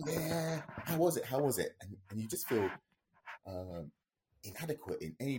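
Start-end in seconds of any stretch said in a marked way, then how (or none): 0.1-1.8 mock eager spectators. Spectators pestering after the jump.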